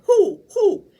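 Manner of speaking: high, loud owl hoots; hollering owl. Acted. Outraged owl hollering at the noise, loud hoots. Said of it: "who hollered".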